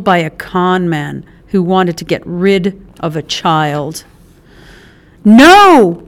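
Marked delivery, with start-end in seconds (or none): 5.2-6.0 loud sharp no; shout. A narrator's reading. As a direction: yell it out to stop her. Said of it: "March shouted".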